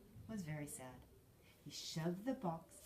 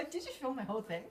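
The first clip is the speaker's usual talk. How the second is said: laughing; 5 semitones higher, 8 dB louder, faster than usual. Real, not acted. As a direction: question through real laughter. Child laughing, surprised by camera.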